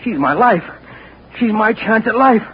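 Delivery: high, pleading and insistent; will her to come back; desperate hope. Acted. Desperate hope she returns.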